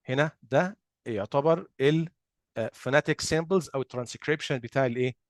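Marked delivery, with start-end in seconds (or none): none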